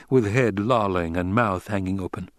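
ordinary delivery

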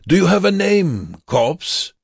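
high, loud taunting question; loud mockery. A narrator's reading. Prisoner questioning dying man before guard, loud and high. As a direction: call it out so others overhear. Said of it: "loud enough so the guard".

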